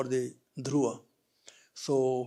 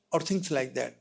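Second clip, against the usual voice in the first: ordinary talk, nothing marked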